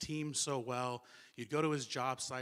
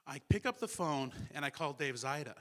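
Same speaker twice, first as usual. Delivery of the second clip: ordinary talk, nothing marked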